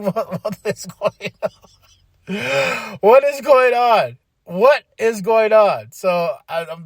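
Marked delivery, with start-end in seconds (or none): 0.0-3.0 slow, drawn-out bewildered question; baffled. Retold or imitated. Fan reacting to the doctor skit, slow and baffled. 3.0-5.9 higher, louder, slow repeated question; exasperated disbelief. Real, not acted. Fan repeating his question at the skit, loud and slow.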